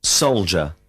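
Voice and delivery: usual speaking voice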